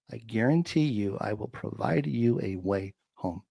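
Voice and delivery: hushed, measured assurance quoting God; quietly adamant. Retold or imitated. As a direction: repeat it softly, weighing each word. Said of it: "he was so adamant about that".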